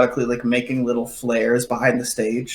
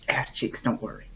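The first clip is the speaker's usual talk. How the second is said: hushed, whispered reassurance; whispered aside; 11 dB quieter than usual. Acted. Bilgy notices a pause and reassures, whispered aside.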